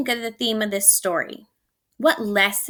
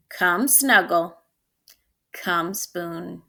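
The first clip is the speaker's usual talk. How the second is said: tender invitation; 4 semitones lower, much slower than usual. Acted. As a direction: coax the child in to cuddle. Mom calls Spoon to snuggle.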